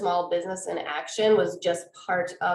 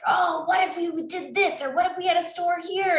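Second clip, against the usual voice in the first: high-pitched voice of mom with big ideas; mock-excited, much higher retelling; imitating excited brainstorming; 11 semitones higher than usual.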